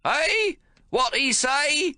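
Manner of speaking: high, loud mock-deaf old man, slow; feigned deaf old man shouting. A narrator's reading. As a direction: shout as if hard of hearing. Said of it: "turns to his son and shouts".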